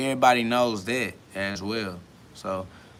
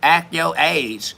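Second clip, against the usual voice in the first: slow, higher, drawn-out mock scolding; 5 semitones higher, 6 dB louder, much slower than usual. Mimicking people who tell him to act his age, mocking. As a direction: drag it out like a preachy nag. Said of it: "They be like".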